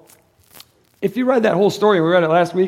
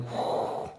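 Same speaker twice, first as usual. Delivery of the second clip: breathy whew; 14 dB quieter than usual. Acted. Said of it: "I mean, like".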